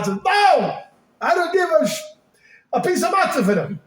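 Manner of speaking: high, impassioned outburst; indignant rant. Acted. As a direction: build to a loud, emphatic refusal. Mock-indignant rant about eating matzah.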